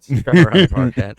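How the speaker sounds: loud, teasing echo of the plan; mock incredulous repeat. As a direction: throw his words back at him, amazed.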